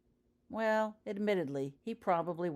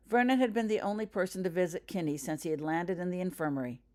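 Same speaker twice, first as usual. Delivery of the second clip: usual speaking voice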